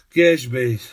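a loud, wistful sighed wish; sighing. A narrator's reading. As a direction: breathe the wish out as a sigh. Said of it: "vzdychla Ksenie".

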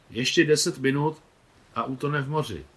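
excited. A narrator's reading. Excited about the sunset.

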